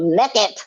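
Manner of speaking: naked with a short e, like "neck-it"; Southern-style naked, rhyming with "wrecked it"; Southern pronunciation. Shown as a demonstration. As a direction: say naked as "nekkid". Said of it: "Like a Southern person".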